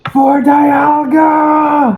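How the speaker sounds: very high, long drawn-out scream; screamed battle cry. Acted. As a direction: scream it out, stretching every word. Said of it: "just sort of scream".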